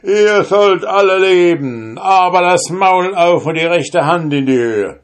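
loud toast. Acted. Soldier bellowing a magic toast over the robbers' heads, loud and high. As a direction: shout the spell over their heads.

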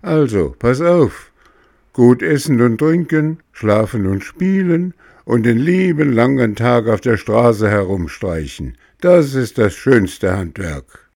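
put-on loud, boastful puppet boy; cheeky boy. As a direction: brag like a brat.

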